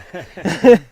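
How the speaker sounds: a loud burst of laughter. Real, not acted. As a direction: laugh out hard at yourself. Laughing about tired lungs.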